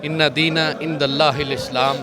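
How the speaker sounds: low, measured Quranic recitation; low recited Arabic invocation. Real, not acted. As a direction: recite the sacred opening formula solemnly, pitched low. Opens answer with Arabic recitation.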